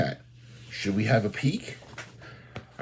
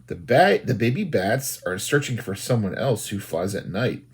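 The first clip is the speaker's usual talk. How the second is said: ordinary delivery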